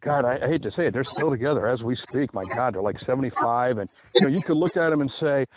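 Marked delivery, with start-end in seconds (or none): none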